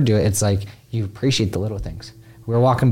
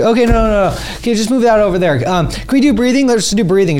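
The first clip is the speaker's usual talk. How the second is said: high, loud, eager mock-wife voice; mimicking his wife; 10 semitones higher, 4 dB louder than usual. Husband mimicking his wife cutting into paperwork, high and loud. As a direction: squeak it out like an overexcited wife pushing breathing.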